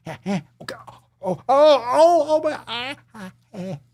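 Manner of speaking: high, loud yelps of pain; pained cries. Real, not acted. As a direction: cry out as the paw lands. Hurt by the dog.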